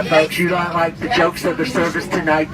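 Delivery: ordinary talk, nothing marked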